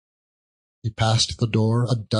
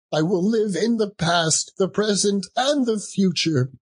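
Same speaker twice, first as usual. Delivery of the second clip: put-on higher, urgent pleading old man; higher earnest vow of a repentant old miser; Scrooge pleading; 8 semitones higher than usual. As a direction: beg the spirit to change his fate. Scrooge's final plea.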